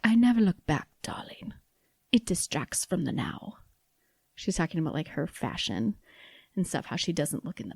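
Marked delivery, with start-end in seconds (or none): 0.0-3.6 Edna Mode. Retold or imitated. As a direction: toss off a light film-character impression with a dramatic start. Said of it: "say this one in an accent".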